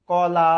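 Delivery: কলা with the final আ drawn out long; long stretched vowel. Shown as a demonstration. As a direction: hold the last vowel of কলা. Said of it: "যতক্ষণ খুশি টেনে উচ্চারণ করতে পারবেন".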